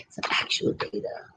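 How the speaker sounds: "Some actual data" whispered under the breath. Real, not acted.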